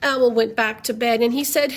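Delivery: usual speaking voice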